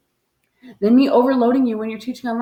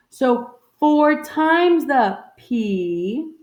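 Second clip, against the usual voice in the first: very slow; 4 semitones higher, much slower than usual. Shown as a demonstration. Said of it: "teacher slows to show".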